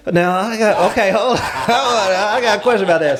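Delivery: amused, high-pitched chuckling protest; laughing. Real, not acted. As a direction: laugh while trying to hold the floor. Host breaks into laughter at answer.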